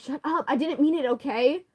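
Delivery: ordinary talk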